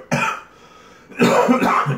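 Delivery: choking, loud coughing fit. Real, not acted. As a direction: cough hard, choking on saliva. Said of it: "Choking on my, uh, saliva".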